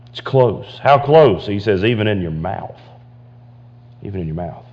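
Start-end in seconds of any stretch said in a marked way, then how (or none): 4.0-4.6 a near-whispered repeat; hushed echo. Shown as a demonstration. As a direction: repeat it much softer, almost under the breath. Soft echo of the verse.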